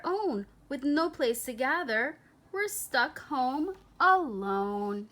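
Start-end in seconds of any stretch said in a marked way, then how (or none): none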